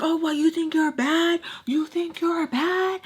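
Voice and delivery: high-pitched, drawn-out taunt; mock challenge. She retells the woman challenging her, mock taunt.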